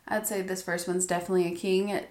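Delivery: ordinary talk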